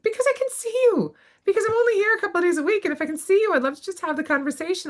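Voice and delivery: high, rushed retort; acted exasperation. Acts out exasperated reply to colleague.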